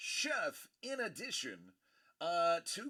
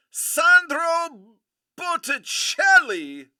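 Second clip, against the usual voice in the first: high, very loud hype yell; excited shouting; 10 semitones higher, 13 dB louder, slower than usual. Real, not acted. Host announcing a one of one Botticelli, excited shouting. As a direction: stretch the big words out in a jubilant shout.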